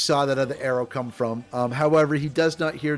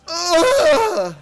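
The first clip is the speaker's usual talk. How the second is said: a high, loud mock pained grunt; goblin grunt; 14 semitones higher, 7 dB louder than usual. GM voicing the goblin's hurt cry, a high mock grunt. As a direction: yelp like a goblin taking an arrow.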